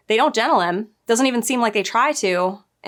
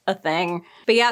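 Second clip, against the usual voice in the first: ordinary delivery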